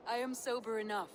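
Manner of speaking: ordinary talk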